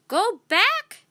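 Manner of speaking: put-on a parrot warns the children; squawked. A narrator's reading. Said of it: "squawked Polly".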